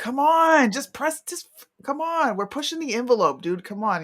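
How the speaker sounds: hyped-up coaxing of a hesitant partner; pushy pleading. Retold or imitated. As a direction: push them to go for it. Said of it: "And I'm like".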